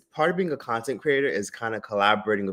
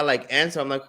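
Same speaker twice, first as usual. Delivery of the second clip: ordinary talk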